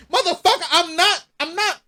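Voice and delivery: retold high-pitched, indignant retort; worked-up reply to being calmed down; impassioned protest. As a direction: push back with passion, pitch jumping up. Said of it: "I'm just speaking with passion".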